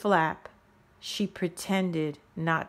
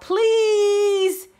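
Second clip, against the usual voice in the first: very high, loud, crying plea for help; tearful high shouting plea; frightened shout; 13 semitones higher, 6 dB louder than usual. A narrator's reading. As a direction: beg to be rescued from the snow. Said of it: "now shouted out".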